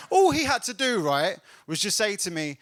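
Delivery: normal talking voice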